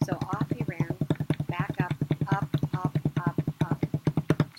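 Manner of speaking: loud chant of the climb; running up. A narrator's reading. Narrator drives the guard's run back up, loudly chanted. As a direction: call each up loudly.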